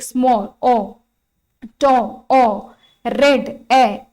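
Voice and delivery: "स्मॉल", "टो", "रेड" said loud and drawn out, each vowel repeated alone; vowel sound demonstration. Shown as a demonstration. Teacher counting vowel sounds for students, isolating "ओ" and "ए". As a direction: stretch "स्मॉल, टो, रेड", echoing the bare vowels "ओ" and "ए". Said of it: "कितनी sound थी?".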